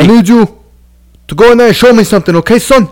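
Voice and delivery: high-pitched impression of a boxing trainer; Buddy McGirt. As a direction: imitate a cornerman urging on his fighter. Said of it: "He did talk like that".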